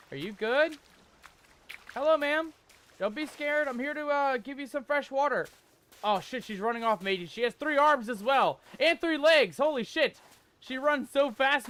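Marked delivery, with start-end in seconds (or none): 1.9-5.5 calling gently in a raised voice; coaxing high voice. Real, not acted. Coaxing a frightened game character. 6.0-11.7 high, loud amazed exclamations; astonished. Real, not acted. Astonished at fleeing three-armed woman.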